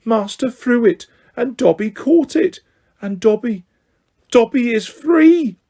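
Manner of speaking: high, squeaky elf voice in disbelief; a tiny elf stunned by freedom; breathless high elf voice; house-elf voice. Acted.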